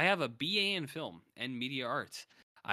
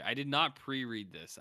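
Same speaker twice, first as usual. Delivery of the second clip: normal talking voice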